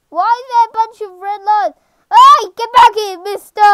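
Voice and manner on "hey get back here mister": loud, high call after someone; indignant shout. Real, not acted. Player shouting at fleeing game enemy, loud and high.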